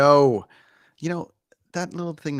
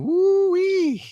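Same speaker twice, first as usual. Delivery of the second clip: excited; 6 dB louder than usual. Real, not acted. Excited exclamation after news.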